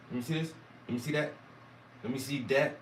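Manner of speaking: this and that said as dis and dat; southern dat. Shown as a demonstration. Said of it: "D A T".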